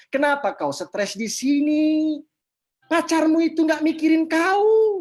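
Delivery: tegur lantang, kata akhir dipanjangkan; omelan gemas. Retold or imitated. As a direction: mengomel tinggi dan lambat. Memarahi anak yang patah hati. (omelan gemas, lantang)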